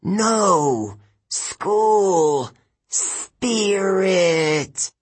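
high, drawn-out dying villain's gasps; gasping. A narrator's reading. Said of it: "She gasped".

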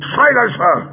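high, startled villain command; shouted order. A narrator's reading. Villain recoiling from captive's anger, shouted order. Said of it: "Greel shouted".

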